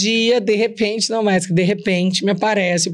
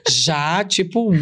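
ordinary talk

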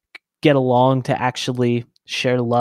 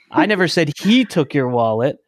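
"he" lifted out of the sentence; stress on HE; 2 semitones higher, 3 dB louder than usual. Shown as a demonstration. Showing how emphasis changes meaning, stress on "he". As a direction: point up the word "he". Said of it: "put emphasis on a different word".

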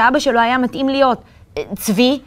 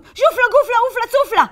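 hurried spell; 11 semitones higher, faster than usual. A narrator's reading. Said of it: "הוסיף במהירות".

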